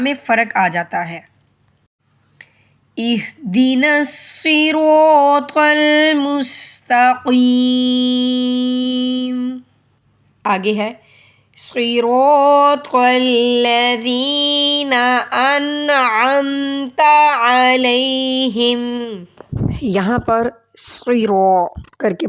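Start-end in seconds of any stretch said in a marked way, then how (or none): 3.0-9.6 slow chant, pressing the ya of وإياك; recite, leaning on the shadda; stressed recitation. Shown as a demonstration. Teacher reciting with the stressed ya. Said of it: "قاف کی آواز دینی ہے". 11.6-19.2 slow chant, pressing the ya of وإياك; recite, leaning on the shadda; stressed recitation. Shown as a demonstration. Teacher reciting with the stressed ya.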